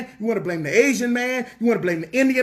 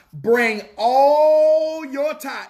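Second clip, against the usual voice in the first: ALL hugely drawn out in "Bring all your tithe"; emphatic divine command; 3 semitones higher, much slower than usual. Acted. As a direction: stretch "all" long and ringing, like a preacher's proclamation. Preacher voices God's command.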